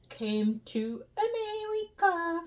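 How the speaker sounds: sing-song, high-pitched flourish; grand flourish. Shown as a demonstration. German immigrant proudly ends her arrival story, grand flourish. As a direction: stretch it out high as a showy finale.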